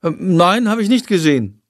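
called out. Acted. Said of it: "ruft Jendrik aus seinem Zimmer".

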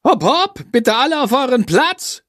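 high, pushy teacher hustling everyone along; urging. A narrator's reading. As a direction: urge them into place, pitched well up. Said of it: "drängelt Frau Schmidt". (drängelnd, mit deutlich erhöhter Stimme)

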